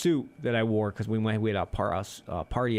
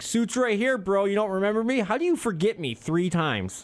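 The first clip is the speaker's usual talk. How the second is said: high incredulous comeback; indignant, pointing at his suit; exasperated retort; 9 semitones higher than usual. Retold or imitated. Customer scolding the forgetful greeter, incredulous.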